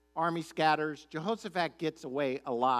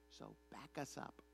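hushed, lower aside; conspiratorial; 5 semitones lower, 19 dB quieter than usual. Retold or imitated. Prophets corner Micaiah before he sees the king, conspiratorial undertone. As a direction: drop right down, as if cornering someone in secret.

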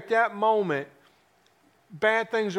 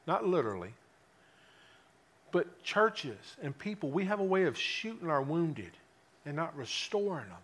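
quiet, confiding; 5 semitones lower, 7 dB quieter than usual. Acted. Preacher turns quiet, confiding.

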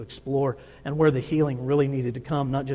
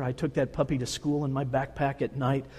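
usual speaking voice

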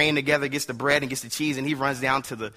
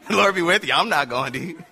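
high, louder blessing breaking into laughter; joking imagined Saul; 4 semitones higher, 4 dB louder than usual. Retold or imitated. Preacher imagines Saul sending David off without him, laughing and joking. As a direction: laugh through it as a mock send-off. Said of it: "I can just imagine Saul like".

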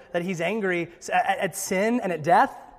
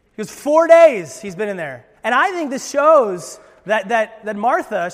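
preaching loud; 3 semitones higher, 11 dB louder than usual. Acted. Preacher building to full force.

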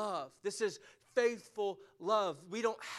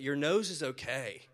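ordinary talk, nothing marked

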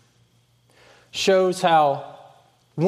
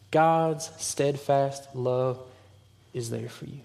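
much softer, lower and very slow; soft, slow, lower comfort; tender reassurance; 4 semitones lower, 6 dB quieter, slower than usual. Real, not acted. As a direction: lay it down gently, like a promise.

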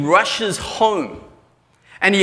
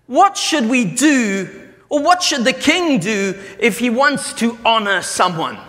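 eager king; 6 semitones higher than usual. Acted. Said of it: "the king says to Haman".

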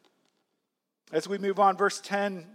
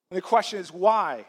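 ordinary talk

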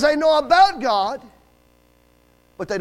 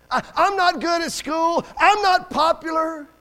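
slow, raised voice rising in pitch; pleading outburst; 5 semitones higher, slower than usual. Retold or imitated. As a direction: cry out a hurting boy's confession, drawn out. Boy pours out his hurt.

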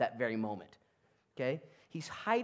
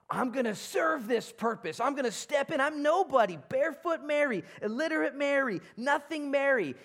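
higher, louder, building passion; rising fervour; 10 semitones higher, 5 dB louder than usual. Acted. Preacher voicing Mary's resolve, rising fervent cry. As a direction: declare it with mounting fire.

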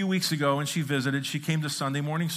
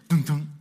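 two firm mouth-made lock thunks; locked car thunk. Shown as a demonstration. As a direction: mimic a locked car door going dun, dun. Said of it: "It goes".

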